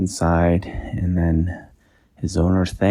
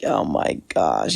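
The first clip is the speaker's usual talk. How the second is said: dismayed inventor; 7 semitones lower, slower than usual. Acted.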